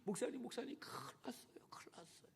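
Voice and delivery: breathless frightened whisper; urgent hushed phone call; panicked whisper. Retold or imitated. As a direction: whisper the bad news in alarm. Daughter calls in panic at night.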